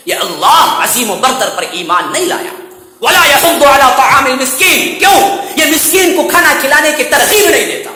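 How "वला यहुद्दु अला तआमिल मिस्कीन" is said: chanted recitation, melodic and raised; Quranic recitation. Acted. Preacher reciting the verse to the congregation, chanted. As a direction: intone the verse with tajweed.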